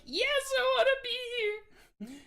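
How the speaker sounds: very high, slow, tearful wail; crying kid insisting. Retold or imitated. Scared kid insisting to the ride operator, tearful wail. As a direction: plead through tears. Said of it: "kinda crying".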